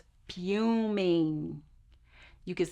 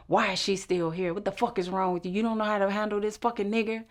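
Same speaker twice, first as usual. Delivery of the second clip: faster, building to a shout; retold angry rant; 4 semitones higher, 6 dB louder than usual. Andy threatening the director, a fast angry rant. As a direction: rail at the director, getting louder. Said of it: "threatening them".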